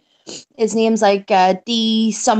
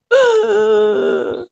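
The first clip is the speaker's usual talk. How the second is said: mock wounded sigh; 9 semitones higher than usual. Mock hurt at toddler.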